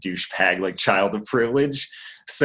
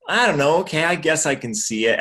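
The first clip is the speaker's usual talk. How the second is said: grudging reconsideration; 8 semitones higher than usual. Retold or imitated. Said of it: "I'll take one sip of it and be like".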